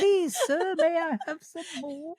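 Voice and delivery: high, pleading little orphan voice; Oliver Twist plea. Pretend. Teasing his co-host with a pitiful orphan's plea. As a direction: beg timidly like a Dickens orphan.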